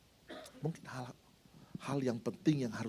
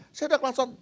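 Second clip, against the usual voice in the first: heated retelling; 12 semitones higher, 6 dB louder than usual. Retold or imitated. Retelling the angkot standoff.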